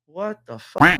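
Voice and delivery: high, loud stunned exclamation; shocked outburst. Retold or imitated. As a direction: blurt it out in disbelief. Shock at her jerking body.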